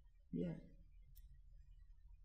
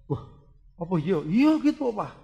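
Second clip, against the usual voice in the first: usual speaking voice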